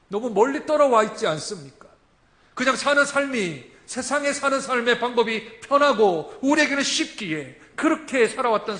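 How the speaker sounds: loud, rising sermon cry; full-force preaching. Acted. Preacher proclaiming to the congregation, loud. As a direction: preach it out to the whole church.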